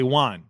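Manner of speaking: JUAN like wand without the d; imitated mispronunciation. Host mimicking his co-host's WON, imitated mispronunciation as JUAN. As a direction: say Juan with an ah vowel, standing in for won. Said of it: "like you're saying wand without the D".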